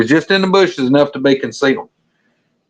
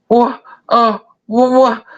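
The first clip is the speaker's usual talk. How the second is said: high, startled stammer; flustered buddy; 11 semitones higher, slower than usual. Retold or imitated. Buddy not following the shooting plan, flustered. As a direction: mimic a baffled buddy, pitched way up.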